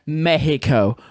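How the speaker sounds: "Mexico" the Spanish way, "Meh-hee-co"; Spanish pronunciation. Real, not acted. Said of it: "The Spanish".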